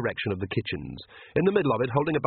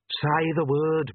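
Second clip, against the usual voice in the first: higher, drawn-out urging; prompting; 5 semitones higher, much slower than usual. A narrator's reading. Inner voice prompting captive girl, high insistent urging. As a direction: egg her on, high and slow. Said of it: "Perdita prompted".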